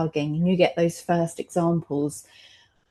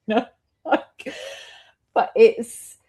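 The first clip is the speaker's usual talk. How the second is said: laughing; 5 semitones higher than usual. Real, not acted. Mother laughing at how her son scares other parents, laughing. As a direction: laugh it off, still half giggling. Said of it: "I know I'm laughing".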